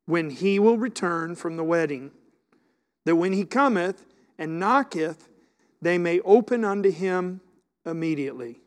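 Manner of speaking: loud, forceful scripture reading; preaching scripture. Acted. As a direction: proclaim it to the congregation. Reads scripture at full force.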